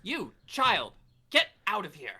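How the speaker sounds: high, loud haughty order; imperious dismissal. Acted. Esther orders the child away, imperious. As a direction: send a child off sharply.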